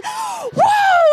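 long, very high-pitched "whoa"; re-enacted scream of excitement; excited scream. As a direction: squeal it out in amazement. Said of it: "I'm, like, screaming".

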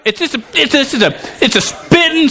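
high, stumbling, spluttering agitation; worked-up mock rage. Shown as a demonstration. Preacher demonstrating spitting anger to congregation, high agitated splutter. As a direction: stay pitched high from the pretend outburst. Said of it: "this is uncontrolled".

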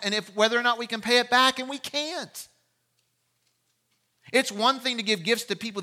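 fervent preacher building on repetition; impassioned preaching. Real, not acted. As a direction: drive each clause home to the congregation. Preaches at full force.

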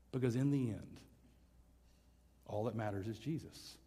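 low, soft, solemn close; hushed reverence. Real, not acted. Preacher asks congregation to bow for prayer, hushed. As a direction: bring it down softly towards prayer. Closing sermon, leading into prayer.

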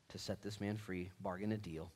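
usual speaking voice

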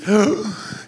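sharp staged gasp of alarm; hammed-up fearful gasp; mock horrified gasp. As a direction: gasp like a scared newscaster. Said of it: "what you hear is".

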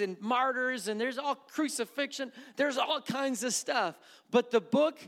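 loud, high, fervent preaching to the congregation; full-force preaching. Acted. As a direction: preach it out, pushing hard.

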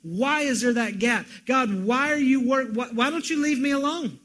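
loud, high pleading questions hurled at God; anguished cry to God. Acted. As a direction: cry out why at heaven in anguish. Preacher voicing anguished cry to God.

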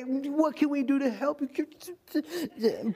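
high, drawn-out fussing; hammed-up sympathy. Preacher imitating family pitying him, hammed-up sympathy. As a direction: fuss slowly over someone in a put-on voice.

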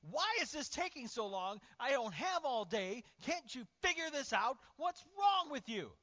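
high, furious parental shout; angry screaming. Acted. Parent screaming at a child who fell down, furious shout. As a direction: scream impatiently at a child, hammed up. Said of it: "you scream at them".

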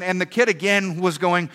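ordinary talk

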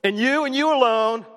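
both you words lifted high and held; stress on you. Shown as a demonstration. Preacher to congregation, pressing you and you alone. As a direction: lean hard on you, and you alone. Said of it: "that word in you in the original text is emphatic".

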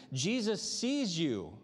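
slow, weighted assurance; tender pastoral emphasis; slow sincere. Real, not acted. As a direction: say it straight to them, slowly. Pastor's direct appeal.

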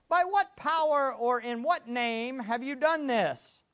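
stern interrogator, drawn out; accusing rulers. Acted. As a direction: drag the question out like an accuser. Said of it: "they were asking".

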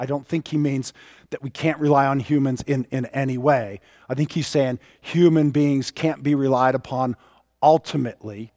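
normal talking voice

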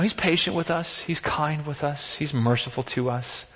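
ordinary talk, nothing marked

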